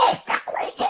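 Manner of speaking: rasping, unvoiced orc growl. Shown as a demonstration. Dubbing director demos a zombie orc voice. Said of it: "como uno escucharía a los orcos".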